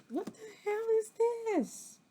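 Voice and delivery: high, fast burst of disbelief; startled what-the-hell; incredulous. Real, not acted. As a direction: squeak it out in shock. Shocked at daggers on card.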